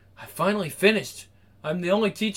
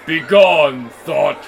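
commanding; 3 semitones lower, 7 dB louder, much slower than usual. Acted. Banishing a tempting thought.

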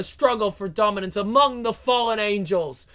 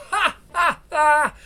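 high, loud mocking cackle; scornful laugh; 8 semitones higher, 5 dB louder than usual. Villainess scoffing at the goddess's mistake, high cackle. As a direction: laugh in her face.

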